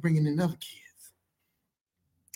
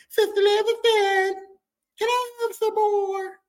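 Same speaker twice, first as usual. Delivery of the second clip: put-on high, slow, pleading little-boy voice; whiny begging kid; 3 dB louder, slower than usual. Foster brother sweetly begging mom for more spaghetti, high whiny kid voice. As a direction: stretch it out like a begging child.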